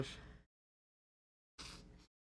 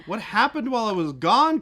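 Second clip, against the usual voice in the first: surprised; 9 semitones higher, 11 dB louder than usual. Real, not acted. Surprised at new diorama.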